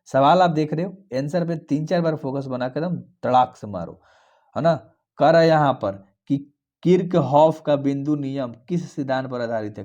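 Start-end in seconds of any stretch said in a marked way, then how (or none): none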